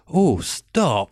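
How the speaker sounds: drawn-out, softened mock objection; changed-mind protest. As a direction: imitate her suddenly not minding at all. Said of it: "puts her glasses on".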